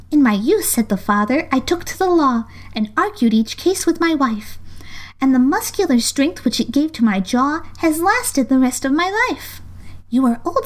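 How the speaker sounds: sing-song recited verse; poem recitation. A narrator's reading. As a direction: recite like a schoolchild.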